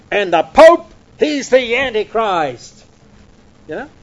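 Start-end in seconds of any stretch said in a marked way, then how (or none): 0.1-2.8 slow, louder mock-zealous verdict; a touch of old pulpit certainty; emphatic. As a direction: pronounce it like a fiery preacher. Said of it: "very emphatically".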